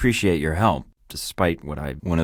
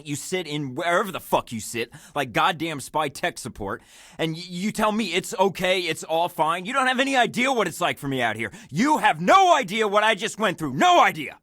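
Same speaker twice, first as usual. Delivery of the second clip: yelling at his handler over the radio; furious outburst; 12 semitones higher than usual. Acted. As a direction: tear into the handler, building to a yell.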